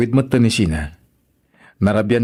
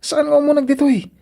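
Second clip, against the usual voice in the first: excited reassurance. Acted. Husband answering, voice pitched high.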